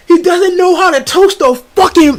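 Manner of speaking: high-pitched mock customer rant; imitated yelling. Customer complaining about the worker to a manager, imitated yelling. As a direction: yell it like the outraged customer. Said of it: "I hear the guy yelling".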